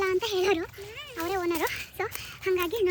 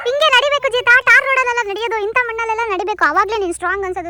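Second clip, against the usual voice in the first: loud urging; 4 semitones higher, 9 dB louder than usual. Real, not acted. Urging daughter over mud path.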